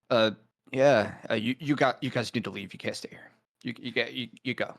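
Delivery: halting, stammered imitation; awkward and weird. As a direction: mimic someone fumbling through asking guests to leave. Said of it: "He says it very awkwardly and weird".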